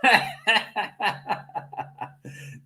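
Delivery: breathless giggle, high and airy; high unvoiced laugh. Real, not acted. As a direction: burst into wheezy laughter. Host cracks up at clip.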